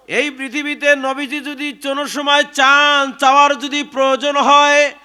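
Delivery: high, loud, sustained sermon cry; full-force preaching. Acted. Preacher hails Ali before the congregation, full-force preaching.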